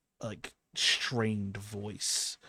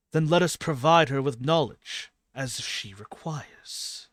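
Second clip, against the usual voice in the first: loud, raised voice of a god; hawk god speaking up; 5 semitones higher, 12 dB louder than usual. Acted. The hawk once more speaks up for the chosen, loud godly proclamation. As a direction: declare it high and forcefully, easing at the end.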